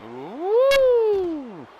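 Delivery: long, high, loud whoop; excited woo. Real, not acted. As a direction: let out a soaring "woo" for the move. Cheering a move in the game.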